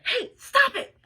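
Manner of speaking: high, loud bark at a sister. Acted. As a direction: snap loudly at a pestering sibling. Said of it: "Coke barked at his sister".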